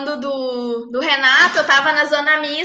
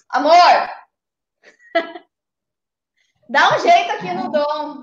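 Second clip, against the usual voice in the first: calling out to another room; raised voice, calling someone over; calling from far away; 4 semitones higher than usual. Real, not acted. Calls partner from another room.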